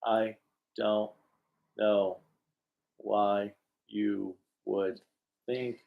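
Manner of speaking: word by word, with big gaps; hammy cartoon actor, haltingly slow; eighties cartoon voice actor. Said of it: "sound like an eighties cartoon voice actor".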